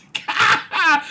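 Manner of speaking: high-pitched burst of laughter; helpless laugh. Real, not acted. Reader laughing at the Doctor's speech, helpless laugh. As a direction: crack up helplessly.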